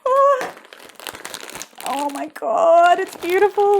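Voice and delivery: high, loud and slow wonder; awed delight. Real, not acted. First seeing the spool, loud awed gasp. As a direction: gasp in awe at it.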